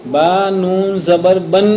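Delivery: "با نون زبر بن" in slow, measured chanting syllables; spelling aloud demo. Shown as a demonstration. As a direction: spell "با نون زبر بن" slowly, ringing the final noon. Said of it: "تو تلفظ تو دونوں کا ایک ہی". ("با نون زبر بن" کھینچ کر ہجے)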